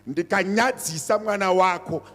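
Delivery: loud preaching, rising and insistent; preaching at full force. Real, not acted. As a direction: drive the warning home to the parents. Pastor warns parents from pulpit.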